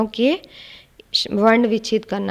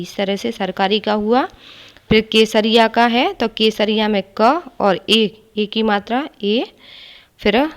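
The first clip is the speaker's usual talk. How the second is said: ordinary talk, nothing marked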